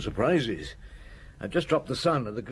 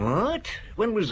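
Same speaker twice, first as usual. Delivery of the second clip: startled what; 12 semitones higher, slower than usual. Acted. Sudden news of a phone call.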